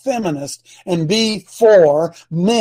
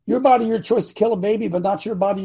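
usual speaking voice

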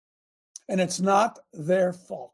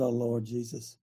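hushed aside; 5 semitones lower, 10 dB quieter than usual. Retold or imitated. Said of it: "I said".

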